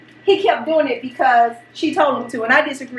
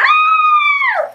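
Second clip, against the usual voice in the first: very high, loud imitated shriek; long high-pitched mock scream; 5 dB louder than usual. Showing what Larry was doing in the water, imitated scream. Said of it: "Tell him what Larry was doing".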